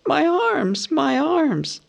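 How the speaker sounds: high, wailing moan of pain. Acted. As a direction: cry out, arms aching. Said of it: "He moaned".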